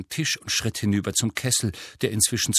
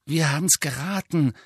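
put-on higher, mildly apologetic girl's voice; 6 semitones higher than usual. A narrator's reading. Hermine admits to Hagrid they guessed, apologetic. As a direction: offer it as a small apology. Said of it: "sagte Hermine entschuldigend".

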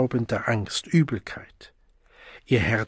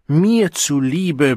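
slow, drawn-out coaxing call, louder; coaxing bellow; 4 semitones higher, 5 dB louder, much slower than usual. A narrator's reading. Siegmund coaxing the Savage through the door, slow loud plea. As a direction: wheedle loudly, stretching each word. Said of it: "brüllte Siegmund schmeichelnd".

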